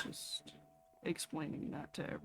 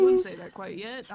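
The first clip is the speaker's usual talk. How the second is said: put-on character voice; 12 semitones higher, 13 dB louder than usual. Character voice cutting in.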